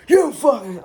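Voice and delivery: annoyed yelp. Retold or imitated. Jumpy reaction to the cat.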